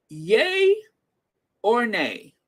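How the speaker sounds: host teasing the chat with a game-show "Yay or nay?"; playful poll call. Acted. Host calling out a poll to the chat, stretched and teasing.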